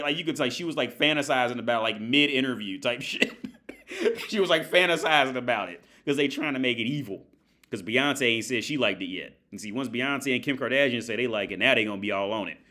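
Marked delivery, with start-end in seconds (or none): none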